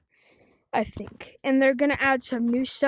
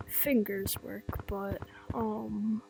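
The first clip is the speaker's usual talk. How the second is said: whispering; 3 semitones lower, 9 dB quieter, slower than usual. Real, not acted. Quiet aside about fingers.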